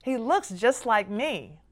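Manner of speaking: ordinary delivery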